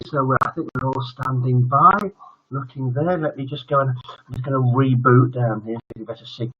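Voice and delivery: normal talking voice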